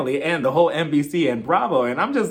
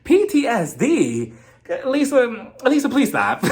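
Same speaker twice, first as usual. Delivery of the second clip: slow, high mock disbelief; exasperated retort; 5 semitones higher, slower than usual. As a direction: scoff at her drama. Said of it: "And I'm like".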